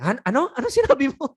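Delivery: high, sharp offended "Ano sinabi mo?"; offended retort. Shown as a demonstration. Listener offended by the calm insult, rising sharply. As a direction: snap back as if insulted.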